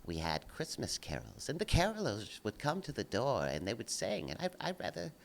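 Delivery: put-on low, soft, prim English old-man voice; a man mocking Richard Dawkins missing Christmas carols, soft and prim; prissy impression. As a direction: mimic a fussy old scholar murmuring fondly. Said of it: "the one who talks like this".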